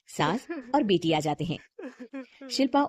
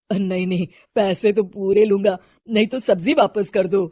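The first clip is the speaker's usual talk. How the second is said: poor seller refusing to haggle; lightly voiced seller insisting on full price; firm vegetable seller; 7 dB louder than usual.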